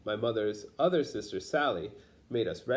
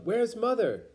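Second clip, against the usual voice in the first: mock yell; 11 semitones higher than usual. Mama calling for Grandma at the fire, high anxious yell. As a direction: call out for her mother in alarm. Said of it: "Mama yelled".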